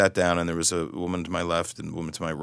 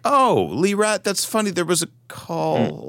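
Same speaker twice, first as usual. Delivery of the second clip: as if the penny just dropped, pitched way up; high, startled, a bit louder; startled realization; 10 semitones higher, 5 dB louder than usual. Retold or imitated. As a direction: voice jumps much higher in sudden surprise. Realizing who she is.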